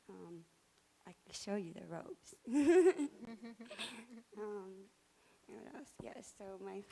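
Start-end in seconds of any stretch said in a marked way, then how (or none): 2.4-4.3 shaky laugh on the verge of tears; nervous tearful laugh. Real, not acted. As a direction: laugh while choking up.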